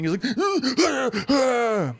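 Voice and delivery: high frantic gasp; panicked gasp. Acted. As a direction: gasp like a man pinned down in panic. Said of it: "he's, like, panicking".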